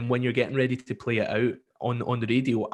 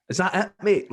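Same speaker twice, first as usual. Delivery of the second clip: high, louder disbelief; incredulous; 7 semitones higher, 4 dB louder than usual. Retold or imitated. Radio host to caller, incredulous and raised. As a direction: ask it like you can't believe it. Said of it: "And you're like".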